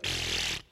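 soft unvoiced raspberry. Wife answering the rude men in line, soft raspberry. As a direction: blow a quiet dismissive raspberry. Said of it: "She just turned around and went".

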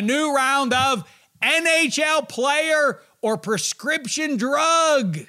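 high, drawn-out hyped announcement; loud, soaring show-opener voice; game show announcer. Pretend. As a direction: stretch it out like a big game title reveal. Announces the game's title.

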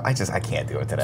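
low, soft mock resignation; hushed, sunken acted despair; mock defeated. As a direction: act out giving up under your breath. Acting out a workplace crisis.

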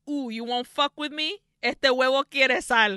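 playful mock challenge, rising to loud; taunting look voiced. Voicing Alucarda's glare at the nun, loud mock taunt. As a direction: goad them, punching the Spanish. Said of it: "looks at her like".

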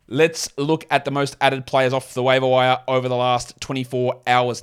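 normal talking voice

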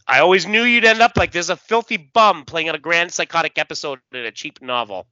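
loud, contemptuous villain, fast; Brainiac. Acted. Villain father sneers at his fallen son, acted contempt. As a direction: start high and forceful with disdain. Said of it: "He says".

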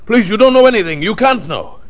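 high, agitated panicked pleading; frantic denial. Acted. Psychic doctor insisting client knows nothing, frantic high-pitched denial.

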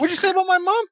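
very high-pitched mock outrage; indignant wrestler. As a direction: squeal it like an offended tough guy. Quoting Tony Marinara's indignant challenge.

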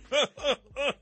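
high burst of real laughter. Real, not acted. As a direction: break into a quick laugh. Laughs at hypocrisy.

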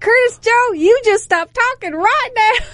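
high, slow Southern drawl; deep south accent. Retold or imitated. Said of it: "Did you really say it in that deep South accent?".

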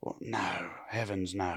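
low, soft fancy English dismissal; quiet, put-on upper-class English. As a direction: murmur it in snooty English. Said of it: "Is this fancy English".

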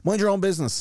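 high-pitched mock telling-off; imitated rebuke. Stranger rebuking someone who steps in, mock indignant.

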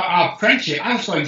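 normal talking voice

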